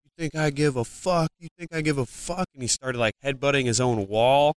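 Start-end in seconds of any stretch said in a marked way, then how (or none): 0.1-2.4 higher-pitched, imitated drunken aggression without shouting; mock aggression, held in volume; aggressive quote. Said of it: "like, just very aggressive".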